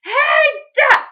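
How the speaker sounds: high, loud calling out; shout. Acted. Witch Grinch hollering at the duck. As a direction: bark for the duck's attention.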